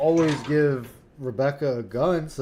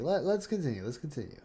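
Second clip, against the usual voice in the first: each repeat much softer until almost gone; fading out; 5 semitones lower, 12 dB quieter than usual. Pretend. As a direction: let the words die away. Repeats line, fading away.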